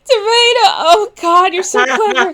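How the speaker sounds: high, loud lovestruck gush; swooning. Acted. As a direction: gush over your crush. Squash swooning over tomato.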